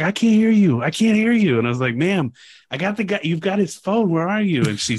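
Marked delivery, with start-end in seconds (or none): 0.0-1.6 loud, high-pitched phone complaint; retold can't-hear call. Woman on the phone who couldn't hear, raised mock holler. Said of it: "couldn't hear". 2.0-4.6 retold insistent appeal. Retold call to woman who can't hear.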